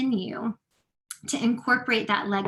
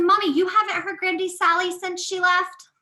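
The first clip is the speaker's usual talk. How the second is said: put-on higher, louder child surprise; surprised child; 8 semitones higher, 6 dB louder than usual. Daughter asking mommy in disbelief, higher surprised voice. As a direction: kid amazed mom hasn't heard her.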